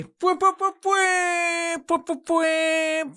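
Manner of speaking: high, slow fake trumpet fwahs; mock horn blasts. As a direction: blast out silly fanfare sounds. Mock fanfare noises.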